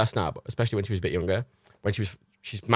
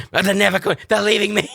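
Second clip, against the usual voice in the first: higher and louder, panicky; frantic dog voice; 9 semitones higher, 6 dB louder than usual. Retold or imitated. Dog Matilda despairing at the window, higher and louder. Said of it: "my impressions of Reagan and Matilda".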